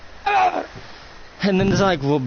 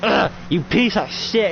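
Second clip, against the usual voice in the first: angry fight taunt; 8 semitones higher than usual. Acted.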